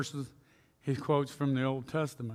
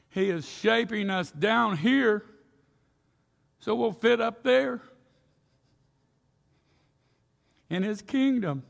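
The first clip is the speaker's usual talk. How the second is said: rising preaching; 7 semitones higher, 4 dB louder than usual. Real, not acted. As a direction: build to the point with fervor. Preaching climax.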